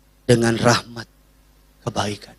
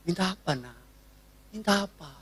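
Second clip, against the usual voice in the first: tender; 11 dB quieter than usual. Retold or imitated. Parent tenderly asking child.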